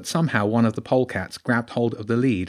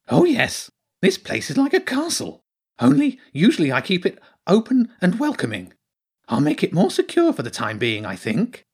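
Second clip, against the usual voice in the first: proud hedgehog character; 8 semitones higher than usual. Acted. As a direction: reassure in a high voice, easing down at the end.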